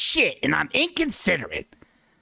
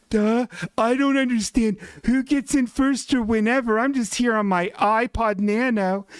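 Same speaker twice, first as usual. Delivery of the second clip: higher dopey mock voice; dullard host; 7 semitones higher than usual. Clueless host answering the angry couple, higher dopey mock voice. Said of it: "this dullard, this idiot behind the counter".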